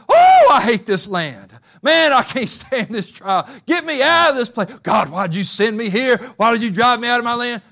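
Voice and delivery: loud, high complaining wail; mock lament. Preacher voicing the woman crying out to God, loud wailing complaint. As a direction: wail it like a fed-up sufferer.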